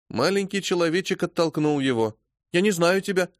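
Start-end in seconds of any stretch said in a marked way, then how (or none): 2.5-3.3 put-on high, childish refusal; high child voice, stubborn; little boy. A narrator's reading. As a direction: push the stranger away. Child rejects stranger father.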